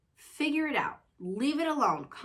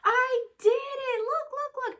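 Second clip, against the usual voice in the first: put-on very high, loud excited little-girl voice; imitating happy daughter; 5 dB louder, slower than usual. Mom imitating her daughter proudly solving the toy, high squeaky child voice. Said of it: "she'll be so happy. She'll be like".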